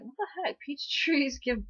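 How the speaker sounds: high-pitched, amused incredulity; mock bafflement. Girl puzzling over the monk's peach tree riddle, mock baffled. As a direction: squeak it in puzzled disbelief, half laughing.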